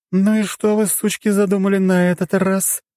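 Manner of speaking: high, irritated tipsy voice; grumpy drunk goddess. Acted. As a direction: grumble at the intruders. Goddess suspicious of visitors.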